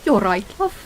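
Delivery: put-on British kid's accent; exaggerated British slang delivery; mock British accent. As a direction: imitate a typical British youngster. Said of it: "a specific accent".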